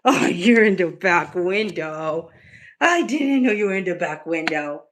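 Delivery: ordinary talk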